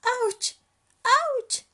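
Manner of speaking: two high, loud ouches; squeezed cub squealing; sharp high cries; pained yelps. Acted.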